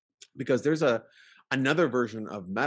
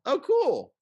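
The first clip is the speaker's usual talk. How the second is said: throwaway "oh cool"; 5 dB louder than usual. Retold or imitated. Quoting a throwaway reaction.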